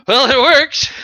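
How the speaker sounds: high, loud put-on voice; smug understatement. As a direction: voice it as a smug character. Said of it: "as you're sitting there going".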